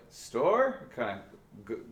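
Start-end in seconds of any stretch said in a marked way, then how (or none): 0.1-0.9 "Store?" sliding up high at the end; scooping up. Shown as a demonstration. Teacher isolating the rising inflection on "Store?". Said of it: "scoops up towards the end".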